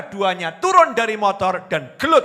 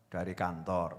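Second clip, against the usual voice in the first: much lower and softer, timid husband; meek reply; 12 semitones lower, 12 dB quieter than usual. Retold or imitated. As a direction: answer quietly, like a tired husband afraid of trouble. Meek husband answers angry wife. (suami menjawab pelan dan takut)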